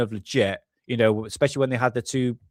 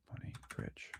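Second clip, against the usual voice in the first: whispered to himself; 24 dB quieter than usual. Real, not acted. Typing a search, muttering.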